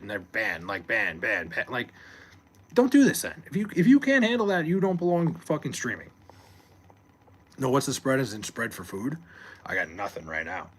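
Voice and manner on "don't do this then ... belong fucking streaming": sudden loud, high outburst at ban-happy streamers; fired-up rant; heated rant. Real, not acted. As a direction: blow up at them, voice jumping up. Ranting about streamers who ban people.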